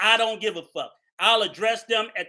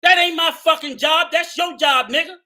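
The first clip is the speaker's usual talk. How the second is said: loud, higher angry shout; 5 semitones higher, 5 dB louder than usual. Real, not acted. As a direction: shout him down. Angry rant.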